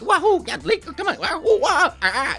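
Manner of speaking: high, playful Mario; mock game shouts, way up. Voice actor showing how he plays games, playful. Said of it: "it's like".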